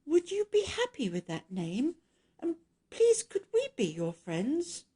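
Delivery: put-on timid, pleading young girl; shy little girl. A narrator's reading. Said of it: "She shyly looked at the creature and asked".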